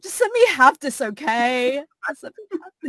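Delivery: high, whiny plea to be left alone; mock pleading. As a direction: beg to keep the moment.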